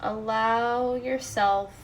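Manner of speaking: soft, unhurried meditation voice; guided meditation. Real, not acted. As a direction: gently guide listeners into letting go.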